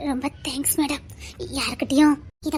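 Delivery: soft, breathless whisper of gratitude; whispering thanks to the madam for the loan. Acted. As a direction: whisper it so no one hears. Secretly thanking for borrowed money.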